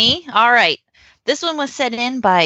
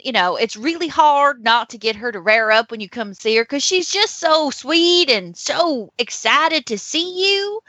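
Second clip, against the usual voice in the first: put-on Southern accent; 3 semitones higher than usual. Owner excuses her young pony's rearing to buyers, Southern accent. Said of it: "it has to be Southern".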